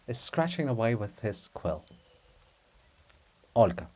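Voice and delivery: ordinary talk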